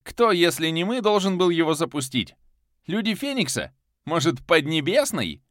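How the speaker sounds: very high, loud challenge; heated shout. Acted. The hero hurls accusing questions at Viktor, loud. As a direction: hurl the questions at him.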